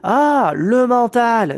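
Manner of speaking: ordinary delivery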